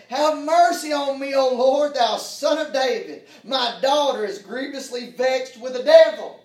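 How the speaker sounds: loud, high, desperate plea; pleading cry. Acted. Mother begging Jesus for her daughter, loud pleading cry. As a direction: cry out for mercy for your daughter.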